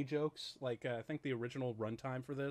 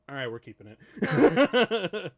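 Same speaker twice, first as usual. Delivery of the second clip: laughing; 4 semitones higher, 12 dB louder, slower than usual. Retold or imitated. Laughing retold quote.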